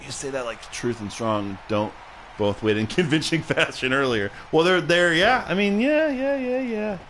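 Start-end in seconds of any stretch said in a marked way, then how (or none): 4.5-7.0 climbing higher with each yeah; flustered conceding. Real, not acted. Streamer conceding a point to chat, flustered and high.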